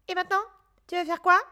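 lightly voiced rabbit question; rabbit character. A narrator's reading. Rabbit voice in story.

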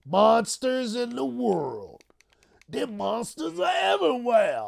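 high, slow, hammed-up warning; mock spooky shout about monsters. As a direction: warn of monsters like a campfire tale.

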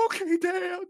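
gasp, then high whimpering mock reply; tearful obedience. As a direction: imitate a kid giving in through sobs. Chastened son sobbing, gasping.

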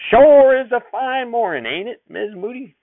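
loud, high, drawn-out folksy call; calling back. Acted. Sheriff calls back to Mother while riding away, loud and slow. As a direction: sing it back over his shoulder while riding off. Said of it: "he turned and called back".

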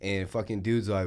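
ordinary talk

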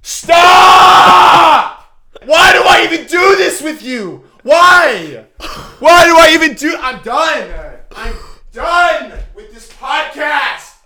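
loud, high exasperated shout; mock outburst. Hammed-up quitting rant at cohost, shouting. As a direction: yell like you are quitting for good.

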